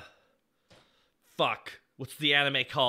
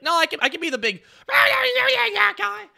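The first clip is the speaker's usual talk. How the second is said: high, loud mock bellow; mock his pitch with a drawn-out yell; eager colleague, hammed-up; 10 dB louder, slower than usual. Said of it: "But Lenny's like".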